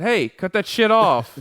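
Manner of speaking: loud, high, slowed-down scolding; retold yell. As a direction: re-enact yelling at the cat. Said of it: "I yelled at her".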